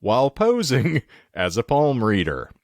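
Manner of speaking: high, swooping headline read; headline announcer. Acted. Host presenting the next Florida man headline, announcer style. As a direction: announce the headline grandly, drawing it out.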